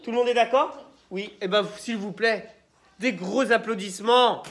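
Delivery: ordinary delivery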